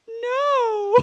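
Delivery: loud, high comic wail. Retold or imitated. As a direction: burst out in a hammed-up bawl. Retold upset answer to boyfriend.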